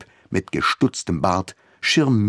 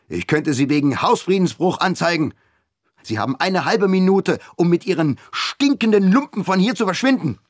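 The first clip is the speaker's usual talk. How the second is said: furious father; 8 semitones higher than usual. A narrator's reading. Colonel throwing squatters out of his flat, furious. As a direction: lay down the law, rising in fury.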